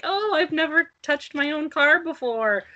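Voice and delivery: mock ditzy old lady, lightly voiced. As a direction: play the clueless lady lightly. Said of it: "ditzy, like".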